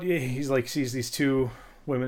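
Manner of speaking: normal talking voice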